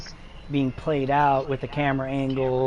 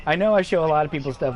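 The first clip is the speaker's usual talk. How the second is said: ordinary talk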